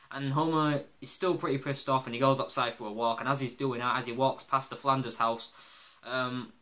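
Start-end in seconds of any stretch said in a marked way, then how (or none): none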